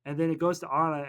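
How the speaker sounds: ordinary talk, nothing marked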